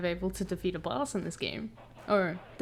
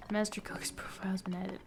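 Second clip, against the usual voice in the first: soft, hushed read; whispered reading; 9 dB quieter than usual. Real, not acted. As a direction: whisper the game text.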